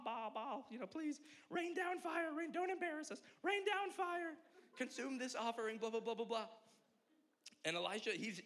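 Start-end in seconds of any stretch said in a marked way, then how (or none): 0.0-6.5 mock crying out. Preacher imitating prophets begging their false god for fire, mock crying out. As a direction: plead in a high, hammed-up wail. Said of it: "they're crying out".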